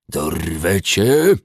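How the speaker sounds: slow, loud hissing dwarf; hissed dwarf threat. A narrator's reading. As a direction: hiss the threat, dragging it out. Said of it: "wysyczał". (syczący krasnolud)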